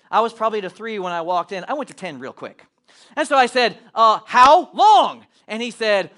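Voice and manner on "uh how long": drawn out, loud and pointed; slow menacing question. Retold or imitated. Father confronting the snooty manager, slow and loud. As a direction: lean on every word, barely holding your temper.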